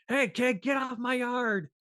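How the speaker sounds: lightly voiced cranky neighbor, pitched high; mock shoo the kid away; grumpy neighbor. Neighbor chasing the kid off his yard, mock cranky.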